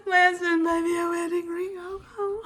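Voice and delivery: teary. Real, not acted. Overwhelmed by wedding ring.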